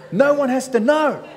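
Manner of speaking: mock hippie voice cracking into laughter; laughing.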